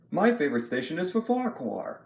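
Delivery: lightly voiced cheerful engine, close to own voice; Thomas. Shown as a demonstration. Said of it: "my normal voice, like, if I just put Thomas out here".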